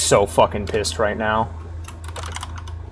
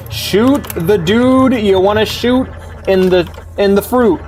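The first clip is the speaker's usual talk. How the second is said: angry rant; 9 semitones higher than usual. Real, not acted. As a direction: blow up at the character in real frustration. Ranting at the useless protagonist.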